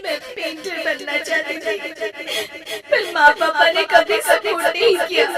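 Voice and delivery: tearful, breaking voice; crying. Acted. Bride sobbing to her husband and mother-in-law. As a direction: speak through sobs about lost dreams. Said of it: "Rajni रोने लगती है।".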